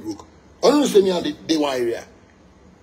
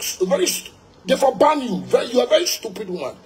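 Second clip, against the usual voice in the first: high, heated insult at the radio host; angry outburst; 8 semitones higher than usual. Real, not acted. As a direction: lash out at her, voice up high.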